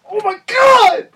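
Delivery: high shrieking scream of shock. Retold or imitated. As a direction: scream it out like the scene just floored you. Reliving shocking episode scene.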